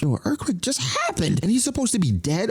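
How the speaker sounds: mock alarm. Viewer freaking out at broadcast.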